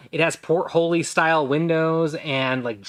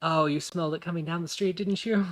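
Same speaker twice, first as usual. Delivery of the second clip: quick, softer knowing question; lightly voiced server, rising at the end; chatty half-elf server; 4 semitones higher, 5 dB quieter than usual. Half-elf server chats with customers about fresh bread, quick and soft. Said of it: "she pivots around".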